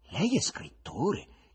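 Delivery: slow, rising, curious question; interested. Acted. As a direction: ask with sudden interest. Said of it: "chiese con interesse il poeta".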